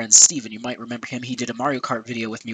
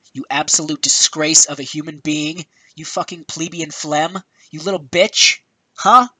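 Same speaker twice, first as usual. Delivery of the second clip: angry insults; 2 semitones higher, 5 dB louder than usual. Real, not acted. Berating Iwata as a liar, angry tirade. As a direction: hurl each insult, louder toward the end.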